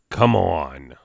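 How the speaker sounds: normal talking voice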